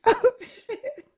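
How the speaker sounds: high, squeaky through laughter; laughing oath. Real, not acted. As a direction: gasp it out mid-laugh. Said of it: "laughing fit".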